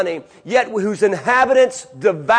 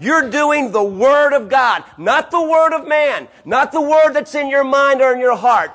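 higher, building emphatic preaching; preaching at full force; 5 semitones higher than usual. Acted. Preacher urging congregation toward the Word, emphatic. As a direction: drive the point home.

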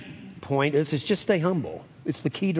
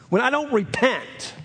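normal talking voice

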